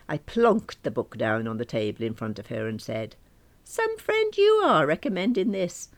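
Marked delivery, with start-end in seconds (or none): 3.6-5.9 high, loud indignant accusation; loud, put-out complaint; indignant reproach. Acted. Narrator confronts friend over book.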